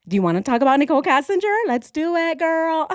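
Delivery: hammed-up high gossiping patient; mock asylum gossip. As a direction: rush into the gossip with giddy glee. Imagined asylum inmate chatting.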